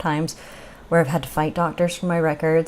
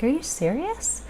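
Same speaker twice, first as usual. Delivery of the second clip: rising, drawn-out disbelief, pitched higher; can't believe it's this easy; incredulous; 8 semitones higher, 6 dB quieter than usual. Retold or imitated. Patient to the scan staff, incredulous and higher.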